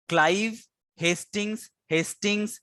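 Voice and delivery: names set apart with rising ends; slow, word by word. Shown as a demonstration. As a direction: dictate the list name by name. Teacher dictates the rhyme slowly.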